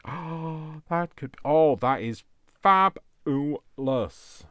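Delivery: high, loud and drawn out in delight; delighted gasp. Real, not acted. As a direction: gasp and savour the word fabulous. Gasps at a pretty find.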